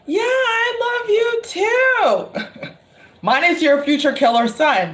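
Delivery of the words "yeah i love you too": slow, high, drawn-out reassurance; hammed-up sympathetic reply; mock-sweet placating. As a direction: stretch it out high, humouring her. Placating the mom, retold.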